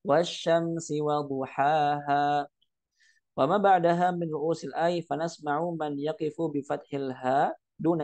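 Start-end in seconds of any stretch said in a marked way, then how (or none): 0.0-2.4 drawn-out chanted recitation; slow Quran recitation. Shown as a demonstration. Teacher reciting the verse slowly to students. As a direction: recite slowly, letting the vowels stretch. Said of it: "firman Allah Subhanahu wa taala".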